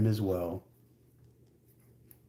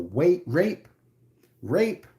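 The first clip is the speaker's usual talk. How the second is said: higher mock cry out a window; mock yelling; 7 semitones higher, slower than usual. Woman yelling accusations after him from a window. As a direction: call it out from the window, half-acted. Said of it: "she starts yelling out the window".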